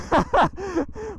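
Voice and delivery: a quick, high little laugh; giggle. Real, not acted. Rider laughing after his stranded prayer, high quick giggle. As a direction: giggle at your own scare.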